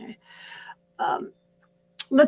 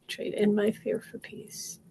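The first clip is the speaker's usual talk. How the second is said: whispered affirmation; 9 dB quieter, slower than usual. Acted. Coach tapping with viewers, whispered affirmation. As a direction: whisper it softly.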